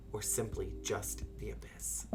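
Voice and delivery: soft ominous whisper; reading dark fishing text aloud. Acted. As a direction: breathe the word abyss. Ominous game narration.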